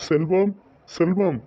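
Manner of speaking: high-pitched call at the door; summoning by name. Acted. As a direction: call for someone inside, pitch lifted. Visitor calling for Selvam.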